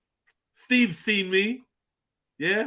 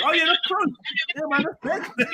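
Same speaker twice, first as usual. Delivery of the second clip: high-pitched, hyped-up approval; mock impressed; 9 semitones higher than usual. New acquaintance warming to a nearby hometown, mock impressed. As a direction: imitate someone suddenly impressed.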